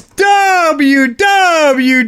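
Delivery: drawn-out high shout. Real, not acted. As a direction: yell it across the room. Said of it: "I didn't tell you to shout".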